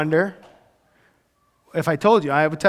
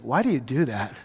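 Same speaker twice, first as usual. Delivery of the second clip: lower, quieter, laughing question; amused wife; 7 semitones lower, 8 dB quieter than usual. Retold or imitated. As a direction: ask it laughing softly. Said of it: "my wife says".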